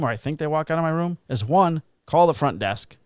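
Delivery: normal talking voice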